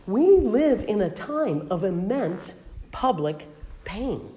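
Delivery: ordinary delivery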